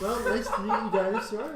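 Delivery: can't hold back a laugh while greeting; the lieutenant greeting the dinosaur while laughing. Real, not acted.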